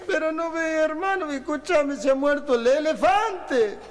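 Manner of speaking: very high, drawn-out tearful wail; mock crying. Santiagueño weeping to his friend over the dead elephant, mock wailing. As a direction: bawl it out in a hammed-up lament. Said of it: "lo mira llorando".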